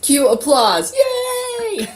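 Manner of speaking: loud, high whoop for herself; mock cheering. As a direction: cheer yourself on like a crowd. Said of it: "I'm just kidding".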